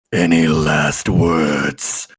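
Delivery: low, slow undead threat; menacing revenant. Acted. Revenant holds the dwarf before killing him, low slow menace. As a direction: draw it out deep and deadly. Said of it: "looks him dead in the eyes and goes".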